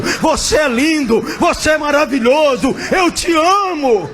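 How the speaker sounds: high, slow, shouted loving declaration; voice of Jesus heard. Retold or imitated. Friend reliving the voice of Jesus, high slow shout. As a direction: shout it like a voice from heaven. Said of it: "eu escutei a voz de Jesus falando".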